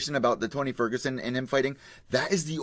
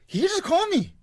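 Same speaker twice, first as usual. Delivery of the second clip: put-on high, heavy-accented Khabib quote; Khabib accent impression; 3 dB louder than usual. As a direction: copy Khabib's accent, pitched up. Repeats the Putin clip line.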